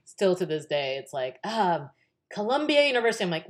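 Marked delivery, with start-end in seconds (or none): 2.3-3.2 imitated proud announcement. Imitating proud announcement of school name.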